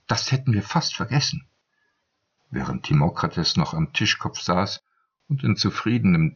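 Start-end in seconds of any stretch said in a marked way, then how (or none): none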